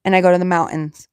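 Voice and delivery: ordinary delivery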